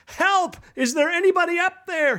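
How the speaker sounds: high-pitched call, no real volume; mock shout for help. Falling climber to anyone above, high mock shout. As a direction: call up as if from down a cliff. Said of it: "he shouts".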